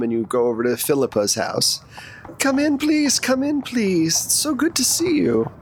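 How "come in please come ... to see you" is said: very high, singsong welcoming hostess voice; gushing hostess. Acted. Philippa welcoming an old friend to her house, gushing. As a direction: usher an old friend in, pitched way up.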